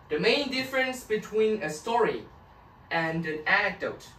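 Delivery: very slow, careful reading. Shown as a demonstration. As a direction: read out word by word for students. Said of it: "teacher reads text aloud slowly".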